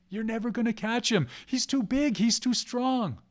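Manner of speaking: high, insistent mockery; taunting spirit. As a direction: tell them off from up high, drawing out the last phrase. Spirit taunts the failing hunters.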